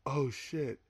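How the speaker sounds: very soft, stunned murmur; hushed awe. Retold or imitated. Listener retelling his reaction to a verse, hushed awe. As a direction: let it out under the breath.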